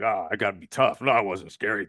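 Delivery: a hint of a macho guy acting tough; mock alpha male. Teasing the alpha male type, a mild mock tough-guy voice. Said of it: "alpha male guy".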